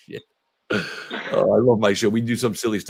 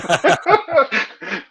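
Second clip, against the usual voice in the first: real laugh; 13 semitones higher than usual. Real, not acted. Host laughs at joke comment.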